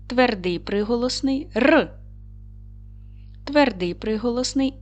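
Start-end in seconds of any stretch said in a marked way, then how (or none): 1.5-1.9 "Р" as a lone hard consonant; short, higher "Р" on its own; isolated sound. Shown as a demonstration. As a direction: sound out "Р", not the letter name. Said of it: "виконаємо звуковий аналіз слова".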